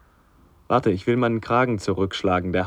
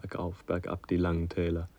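resigned murmur; 4 semitones lower, 10 dB quieter than usual. Acted. Son murmurs the refrain, resigned.

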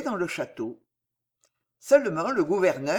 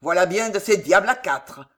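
scornful, forceful remark; disdainful grumble; 6 dB louder than usual. A narrator's reading. Governor sizes up the silent prisoner, disdainful grumble. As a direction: dismiss him with contempt. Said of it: "grommela-t-il dédaigneusement".

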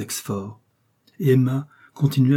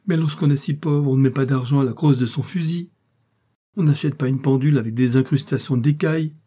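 higher, louder indignant reproach; scornful tirade; 5 semitones higher, 5 dB louder than usual. A narrator's reading. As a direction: hurl scorn at his riches. Scornful tirade at wealthy lover.

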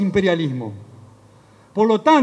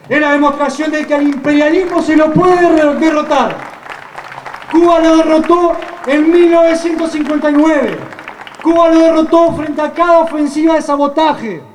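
fiery rally oratory; 6 semitones higher, 5 dB louder than usual. Real, not acted. Rally speech, Cuba defeated imperialism.

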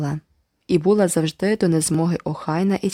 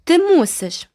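high loud stubborn demand; insist, won't back down; 11 semitones higher, 4 dB louder, slower than usual. A narrator's reading. Girl insisting to the boy, stubborn. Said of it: "уперто наполягала Айві".

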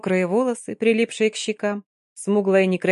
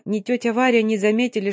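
usual speaking voice

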